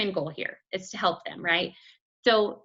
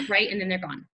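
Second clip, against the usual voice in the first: usual speaking voice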